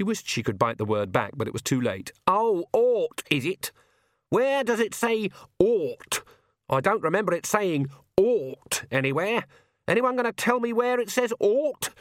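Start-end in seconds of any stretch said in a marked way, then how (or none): 2.3-11.9 high, scornful, rising outrage; indignant old witch. A narrator's reading. Granny rounding on Agnes over ought, scornful. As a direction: pounce on the word ought.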